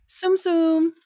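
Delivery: high little nonsense chirp; Simlish gibberish. Shown as a demonstration. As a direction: pipe up with made-up syllables. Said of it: "actual words are gibberish".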